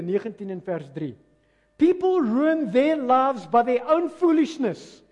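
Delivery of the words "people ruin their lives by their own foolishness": loud, high, slow proclamation; raised-voice preaching, every word driven home; emphatic scripture reading. Real, not acted. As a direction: declaim the verse to the whole room. Preacher declaims the scripture verse.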